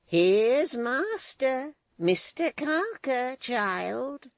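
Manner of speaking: put-on an old hag toasting the master, slowly; slow old woman. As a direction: savour each word like a sly old crone. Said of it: "said the old woman slowly".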